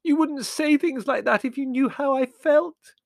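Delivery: high, loud, slow tearful wail; hurt girl's high-pitched outburst; bursting into tears. Acted. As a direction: wail it through acted tears. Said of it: "bursting into tears".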